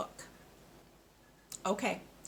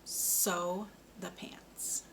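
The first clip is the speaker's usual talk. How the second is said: "Sew the pants" said very slowly, each word apart; slow model sentence; 4 dB quieter, much slower than usual. Shown as a demonstration. Speech therapist models a sentence for a child, slow and careful. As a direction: draw it out slowly for the learner. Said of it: "One more".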